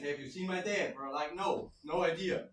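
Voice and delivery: ordinary talk, nothing marked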